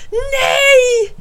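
very high, loud drawn-out cry; shouted refusal. A narrator's reading. Alf refuses to watch it again, shouting. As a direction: shriek in horrified refusal. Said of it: "ropar Alf".

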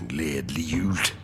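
low, soft, sour growl. Acted. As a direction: grumble it with contempt, almost voiceless. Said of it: "knurret Scrooge". (lav, gretten knurring)

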